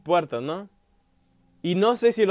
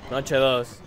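usual speaking voice

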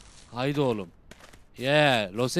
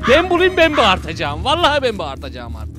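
loud vow; 4 semitones higher, 11 dB louder than usual. Real, not acted. Vowing to make ghost scream.

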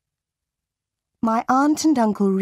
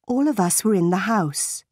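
ordinary delivery, nothing marked

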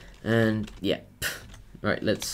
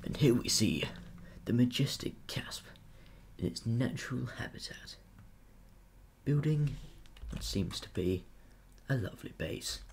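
nature documentary; 3 semitones higher than usual. Pretend. Nature documentary narration of Casp.

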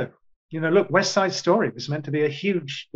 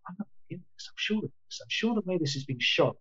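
retold thought, murmured much softer; hushed inner thought; 4 semitones higher, 8 dB quieter than usual. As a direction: say it under your breath, as if thinking to yourself. Own worried thought, voiced low.

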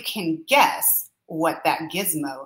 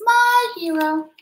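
high, louder squeaky-sweet admiring mouse; little mouse; 8 semitones higher, 4 dB louder than usual. Acted. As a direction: gush like a tiny admiring mouse. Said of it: "the little mouse down here says".

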